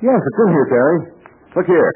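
excited discovery. Acted. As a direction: call out the find eagerly. Discovers the hidden radio.

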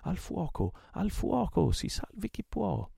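high, drawn-out alarm cry; yelling. A narrator's reading. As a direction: raise a panicked fire alarm. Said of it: "quando si udì urlare". (urlo d'allarme, acuto e prolungato)

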